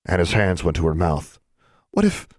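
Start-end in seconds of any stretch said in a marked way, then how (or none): 1.9-2.3 put-on high-pitched, worried girl's voice; anxious girl. A narrator's reading. Pale girl dreading the dean's summons, anxious. Pale Anna fears bad news.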